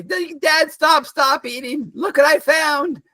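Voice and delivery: put-on high, slow alarmed girl voice; a girl crying out at her dad; high Lisa voice. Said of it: "and goes".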